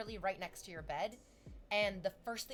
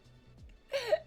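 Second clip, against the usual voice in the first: bright laugh; 6 dB louder than usual. Real, not acted. Laughs after singing.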